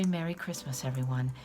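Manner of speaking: ordinary delivery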